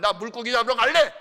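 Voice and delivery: high, very loud declaration; acted defiant shout. Peter announcing he is off fishing, mock defiant shout. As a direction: blurt it out loudly as stubborn Peter.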